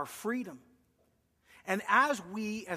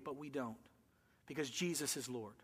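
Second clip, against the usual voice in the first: quiet conviction; 6 semitones lower, 10 dB quieter than usual. Real, not acted. Pastor stating core conviction.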